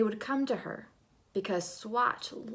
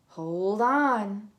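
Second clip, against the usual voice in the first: much louder friendly call; color's call; 9 dB louder than usual. Acted. Yellow telling Swatch to hold on, much louder. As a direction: warn her to grab on. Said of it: "yellow said".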